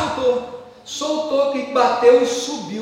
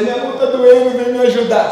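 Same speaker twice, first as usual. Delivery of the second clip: mock whimper, higher and louder; whiny plea for help; 4 semitones higher, 9 dB louder than usual. Man mocking himself whining to his wife for help. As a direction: ham up a helpless husband calling for help. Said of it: "não vai dizer".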